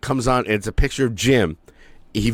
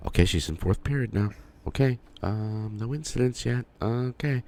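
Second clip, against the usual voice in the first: anxious muttering; 8 dB quieter than usual. Retold or imitated. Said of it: "I'm like".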